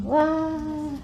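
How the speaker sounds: ordinary delivery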